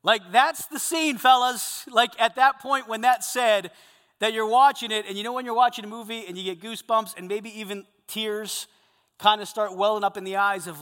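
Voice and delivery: loud, high, driving sermon delivery; preaching at full force. Acted. Preacher telling the men the Elisha story, loud and driving. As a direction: proclaim the story with mounting force.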